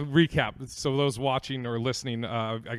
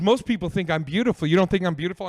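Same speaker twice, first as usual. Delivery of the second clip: insistent date voice; 3 semitones higher, 7 dB louder, faster than usual. Retold or imitated. Her voiced insisting on beauty.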